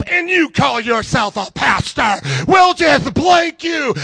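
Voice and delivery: loud, hammed-up indignant insult; mock angry shout, climbing high; mock cussing out. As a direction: imitate someone cussing out the pastor. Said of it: "cussing me out".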